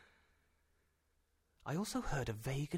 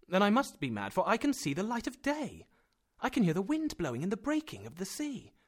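high, loud, urgent insistence; raised pitch, worked up and incredulous; agitated bewilderment; 8 semitones higher, 5 dB louder than usual. A narrator's reading. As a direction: protest as if doubting your own sanity. Nephew insists he sees daylight.

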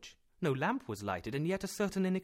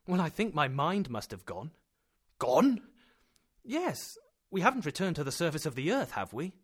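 bewildered; 4 semitones higher, 7 dB louder than usual. A narrator's reading. Nephew doubts his own sanity.

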